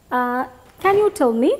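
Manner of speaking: ordinary talk, nothing marked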